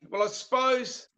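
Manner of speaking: ordinary talk, nothing marked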